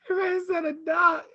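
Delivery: high crying-laugh storytelling. Real, not acted. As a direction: tell it while crying laughing. Laugh-crying retelling the scene.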